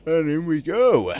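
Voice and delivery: high, loud, slow sing-song announcement; playful triumph. Real, not acted. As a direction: drag it out like a little victory fanfare. Placing arrowhead, playful triumph.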